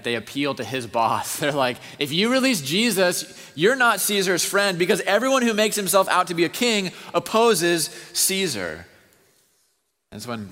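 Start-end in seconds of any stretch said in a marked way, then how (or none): 0.0-8.9 loud, much higher, driving sermon voice; preaching at full force. Acted. Preacher thundering the trial scene at the congregation, loud and high. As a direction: pound it out to the back row.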